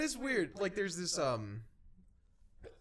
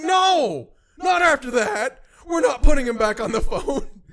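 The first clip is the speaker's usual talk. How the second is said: high, loud, emphatic mock protest; shouted refusal; 10 semitones higher, 14 dB louder than usual. Refusing the nervous friend's plan to call back, shouting. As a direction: shout it down, slowing on each point.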